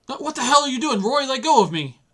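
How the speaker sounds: high, loud, furious protest; angry shout. Acted. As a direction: yank free and yell at Roy. Detective struggles, being pulled in.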